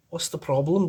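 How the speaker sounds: a quick imitation of a Liverpudlian woman; Liverpudlian accent. Reviewer quoting the film's Liverpudlian translator voice, imitating the accent. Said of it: "in a very Liverpudlian accent".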